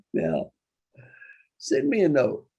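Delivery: usual speaking voice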